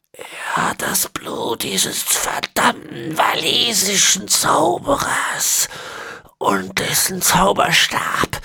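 put-on high, slow, whispery old man; ancient villain. A narrator's reading. Ezekiel explaining the horse bones, high and whispery. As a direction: rasp out the words like a centenarian.

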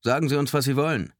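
high, loud public appeal; megaphone voice. A narrator's reading. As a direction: project it as through a megaphone. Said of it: "ertönte es aus dem Megafon".

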